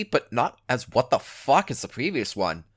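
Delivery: plain reading voice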